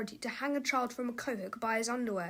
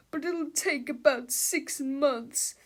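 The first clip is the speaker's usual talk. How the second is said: whining; 3 semitones higher, slower than usual. A narrator's reading. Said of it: "whined Mister Melvin".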